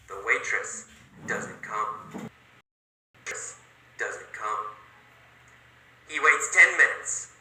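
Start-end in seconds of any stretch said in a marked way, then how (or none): 0.1-2.3 hushed letdown. A narrator's reading. Waiting in vain, dropped voice. 3.2-4.6 hushed letdown. A narrator's reading. Repeated, still hushed.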